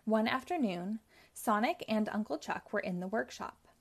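usual speaking voice

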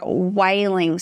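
normal talking voice

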